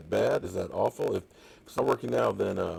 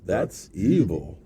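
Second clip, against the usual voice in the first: evil voice; 2 semitones lower, 5 dB louder, much slower than usual. Shown as a demonstration. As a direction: drag it out, menacing and slow. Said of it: "that's the evil voice".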